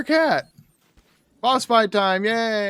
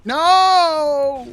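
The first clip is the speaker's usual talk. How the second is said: loud cry; 13 semitones higher, 7 dB louder than usual. Pretend. Boss fight, dramatic moment.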